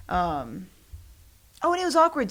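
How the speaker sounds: ordinary delivery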